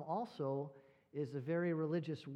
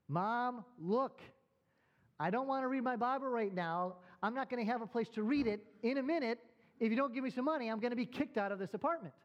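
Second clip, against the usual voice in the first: higher, louder, mock exasperated shouting; exasperated yelling; 7 semitones higher, 5 dB louder than usual. Son yelling at mom over the phone, a retold exasperated shout. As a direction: blow up at mom in a retold rant.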